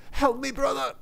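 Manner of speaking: very high panicked plea; crying for help. Acted. Drowning soldier to Saint Peter, very high panicked cry. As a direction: shout for rescue. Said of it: "cried the soldier".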